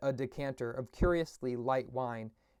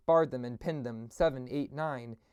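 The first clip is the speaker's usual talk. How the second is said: ordinary delivery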